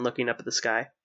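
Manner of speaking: ordinary delivery, nothing marked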